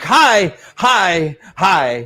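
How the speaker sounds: loud, eager, high-pitched hellos, fading down; excited hi. Retold or imitated. Newcomers greeting the community with bravado, loud and eager. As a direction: greet everyone with too much bravado. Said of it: "and we're like".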